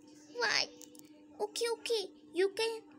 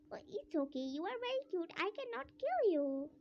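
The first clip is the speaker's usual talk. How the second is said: ordinary talk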